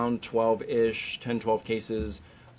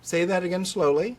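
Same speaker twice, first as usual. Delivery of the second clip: higher-pitched, pointed request; pointed retort; 5 semitones higher than usual. Retold or imitated. Lecturer replying to doctors who don't test, pointed. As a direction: quote yourself nudging them to think. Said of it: "I'm like".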